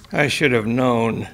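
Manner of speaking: plain reading voice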